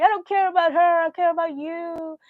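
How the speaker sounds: high, loud retold wail; drunk, tearful protest. Drunk brother to his sister, wailing protest. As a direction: wail it as an imitated emotional drunk. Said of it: "he was drunk".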